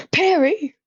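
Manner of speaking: high, loud call of a name. Real, not acted. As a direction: shout the name to someone nearby. Calling out to Perry.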